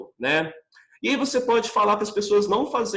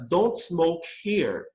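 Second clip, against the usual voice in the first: slow model; much slower than usual. Shown as a demonstration. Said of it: "eu posso falar".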